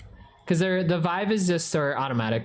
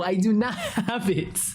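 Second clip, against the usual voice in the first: laughing through the denial; giggling while insisting; 5 semitones higher than usual. Real, not acted. Denying amid laughter.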